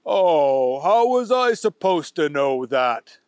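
innocent Booger. Acted. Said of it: "Booger said".

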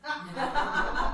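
high mocking laugh. Laugh after councilman's denial.

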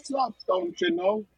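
very high Minnesota-style "Sup, don't you know?"; high Minnesotan accent; northern accent. Pretend. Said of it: "northern accent".

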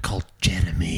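whispered aside. Pretend. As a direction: breathe it, unvoiced.

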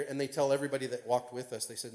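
ordinary talk

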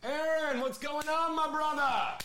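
big on-air shout-out to the caller; excited greeting. Acted. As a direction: greet the caller like an old friend.

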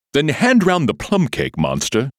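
loud, high-rising command; commanding lion. A narrator's reading. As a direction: order the servant about loudly. Lion gives orders in a story.

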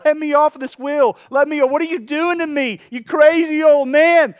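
high, loud yelling from the spinning clay; acted-out scream, stretching the last words. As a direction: yell to be let off, outraged. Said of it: "I'm the whole time screaming back and forth".

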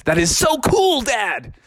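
put-on high, excited child voice; admiring excitement. Froggy admires dad's T-Rex costume, excited. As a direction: leap up high on the praise.

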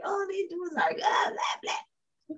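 hammed-up childish griping about the arguing; a teen mocking relatives who argue all the time; mock exasperated rant. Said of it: "why did we sound like Uncle Dwayne and Aunt Faith".

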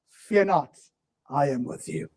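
low, soft, tender promise; God comforting his people, softly; soft reassurance. Acted. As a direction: whisper the comfort gently.

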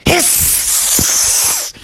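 long, sharp cobra hiss; snake hiss. Acted. Cobra surprised under the rock, hissing.

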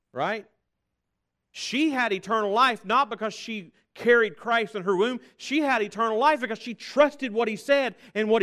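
loud, high fervent preaching; preaching at full force. Acted. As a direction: hammer the point home to the congregation. Driving home the sermon's point.

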